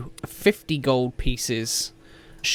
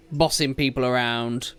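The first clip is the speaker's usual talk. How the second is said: ordinary talk, nothing marked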